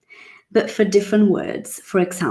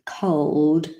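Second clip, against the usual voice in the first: ordinary delivery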